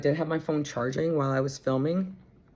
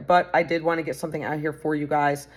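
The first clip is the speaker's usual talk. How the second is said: ordinary delivery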